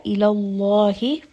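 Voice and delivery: Ilallahi with a thick, heavy lam, slowly. Shown as a demonstration. Teacher demonstrating heavy lam in Allah. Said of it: "name of Allah here is heavy".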